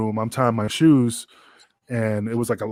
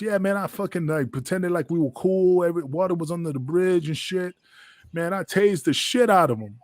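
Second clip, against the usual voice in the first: bragging Jack; 6 semitones higher than usual. Retold or imitated.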